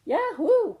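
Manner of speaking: high, loud gleeful whoop; excited cry. Acted. Little cub cheers at going to find God, excited whoop. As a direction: shout it out with joy like a delighted cub. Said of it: "cried little cub".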